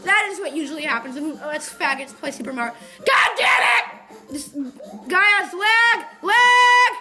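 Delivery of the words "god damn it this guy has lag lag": higher, much louder furious yells; angry shouting. Real, not acted. Gamer screams at the laggy game. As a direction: explode at the lag.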